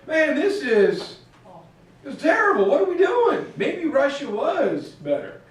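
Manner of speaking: high, loud mock complaint; hammed-up exasperation, pitched high; exasperated dismay. Weary migrants complaining in Iran, exasperated dismay.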